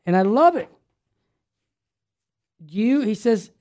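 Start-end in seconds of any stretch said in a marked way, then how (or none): none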